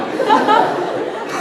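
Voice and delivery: high burst of real laughter; helpless high laugh. Real, not acted. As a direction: crack up at your own joke. Laughs at own bald-head joke.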